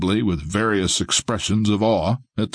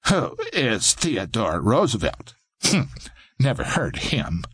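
smirking; 2 semitones higher than usual. A narrator's reading.

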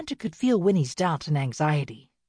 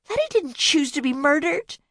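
higher, louder, exasperated cry; exasperated outburst; 11 semitones higher, 5 dB louder than usual. Acted. Bea snaps back at Winnie, exasperated and louder. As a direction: burst out, fed up. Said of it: "Bea spouted off, exasperated".

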